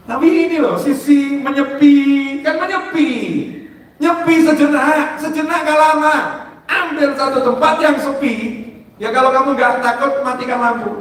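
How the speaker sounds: high, loud preacher's call to retreat; fervent preaching. Acted. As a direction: urge them on from the pulpit. Preaching at full force.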